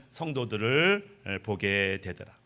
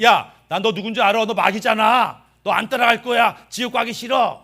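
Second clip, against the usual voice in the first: high, loud defiant rebuke; 6 semitones higher, 6 dB louder than usual. Acted. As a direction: stand up to the devil and shout him down. Rebuking the devil. (마귀를 꾸짖는 높고 큰 목소리)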